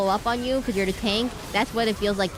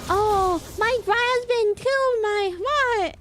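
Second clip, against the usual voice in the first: much higher, slow falsetto lady voice; 1920s radio drama lady; 14 semitones higher, 3 dB louder, much slower than usual. Retold or imitated. Streamer mimicking a 1920s radio drama widow, high falsetto. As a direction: ham up an old-timey radio damsel. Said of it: "he's like".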